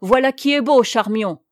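narrated soldier's angry outburst; angry soldier's cry. A narrator's reading. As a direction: call it out in wrath. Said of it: "s'écria d'une voix courroucée".

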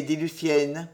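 ordinary delivery

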